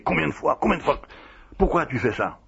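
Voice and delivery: imitation légère d'un cri, voix plus aiguë mais pas plus forte; cri rapporté. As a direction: faire entendre le reproche de Padre Pio sans vraiment crier. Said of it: "Il se mettait à crier". (un petit cri imité, plus haut que d'habitude)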